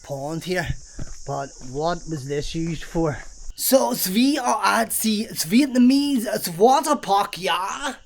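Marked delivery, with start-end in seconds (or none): 3.5-7.9 slow, higher-pitched mock German accent. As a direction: put on a hammy German accent. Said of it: "why I done a German accent instead of a Vietnamese".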